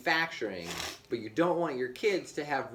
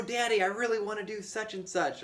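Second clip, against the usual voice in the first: put-on higher pleading kid voice; child voice; 8 semitones higher than usual. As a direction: play the eager little kid. Dad voicing his pleading kid.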